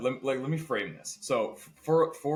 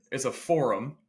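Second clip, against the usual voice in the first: ordinary delivery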